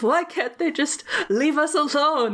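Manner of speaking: high put-on whiny vampire; lightly voiced petulant Dracula; mock Dracula. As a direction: whine it like a sulky count. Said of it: "he says".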